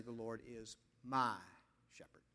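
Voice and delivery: low, soft verse, pausing after "my"; quiet, weighty "my" before a pause; stress on "my". Shown as a demonstration. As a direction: dwell on "my", then drop "shepherd" to almost nothing. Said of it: "you must be able to say".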